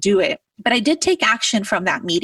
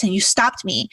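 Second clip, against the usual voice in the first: ordinary talk, nothing marked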